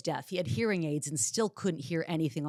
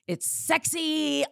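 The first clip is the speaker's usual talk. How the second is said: sultry; 11 semitones higher, 5 dB louder, much slower than usual. Real, not acted. Gushing about new apartment.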